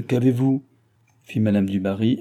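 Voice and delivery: ordinary delivery, nothing marked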